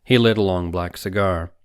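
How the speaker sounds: usual speaking voice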